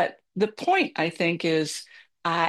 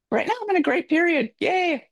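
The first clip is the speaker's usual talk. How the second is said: bright cheer; 11 semitones higher than usual. Real, not acted. Cheering about a migraine-free period.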